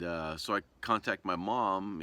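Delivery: ordinary talk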